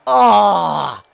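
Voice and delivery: loud anguished cry; frustrated yell. Real, not acted. Gamer crying out at his player's penalty, frustrated yell. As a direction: let out a pained shout.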